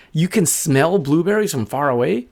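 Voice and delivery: high, incredulous bird voice; high-pitched bird question; amazed little bird. Acted. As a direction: question it as an astonished small bird. Bird amazed by wolf's nose.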